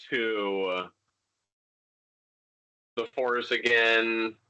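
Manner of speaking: ordinary talk, nothing marked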